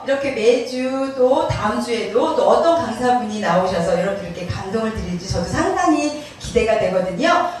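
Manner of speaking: normal talking voice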